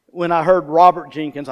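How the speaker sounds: usual speaking voice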